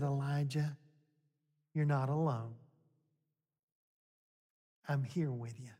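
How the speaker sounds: soft and low, comforting a broken man; tender comfort. Acted. God gently reassuring Elijah, soft and low. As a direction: lay an arm around him with your voice.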